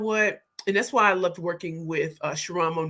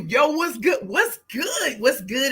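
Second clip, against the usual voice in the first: hyped; 4 semitones higher, slower than usual. Real, not acted. Hyping up viewers.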